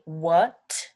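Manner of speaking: "what" with a crisp final T; T at the end. Shown as a demonstration. Teacher showing a crisp T in "what". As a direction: land the T in "what" clearly. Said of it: "you wanna put that T on the end".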